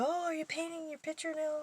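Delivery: high, bright-eyed mock-eager question; retold excitement. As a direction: imitate the friend's eager delight. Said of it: "she got all excited".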